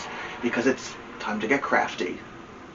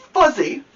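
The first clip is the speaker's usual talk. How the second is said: fuzzy said high and louder, falling; stressed fuzzy; 12 semitones higher, 5 dB louder than usual. A narrator's reading. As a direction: lift fuzzy up high then drop it. Building suspense in story.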